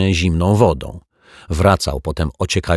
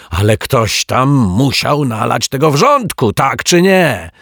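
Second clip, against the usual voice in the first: higher, louder indignant ranting; fuming; 7 semitones higher, 5 dB louder than usual. Acted. The lodger fuming at the detective, angry. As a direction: rage and demand an answer. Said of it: "pieklił się martwiak".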